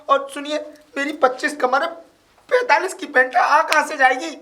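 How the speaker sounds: high-pitched, insistent arguing; exasperated protest. Acted. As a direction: prove your point with pitch right up. Argues pants cannot be his.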